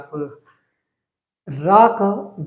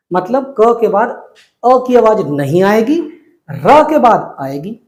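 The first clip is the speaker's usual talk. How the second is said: ordinary talk, nothing marked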